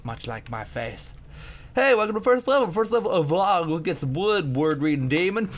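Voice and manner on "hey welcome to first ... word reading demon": lightly hammed-up character read; put-on reading voice. Gamer reading a level's welcome text, silly voice.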